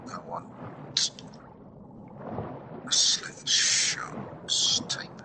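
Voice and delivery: soft whisper while browsing a game menu; whispering to self. Real, not acted. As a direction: murmur to yourself while picking items.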